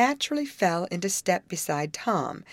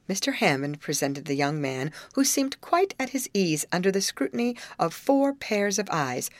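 usual speaking voice

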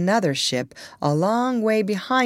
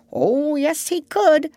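high-pitched emphatic duck; insistent duck; 9 semitones higher than usual. A narrator's reading. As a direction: insist he could, higher. Dab-Dab insists.

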